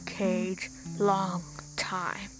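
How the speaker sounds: a weary caged beast speaking slowly; gruff lion man. Acted. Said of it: "in a gruff voice".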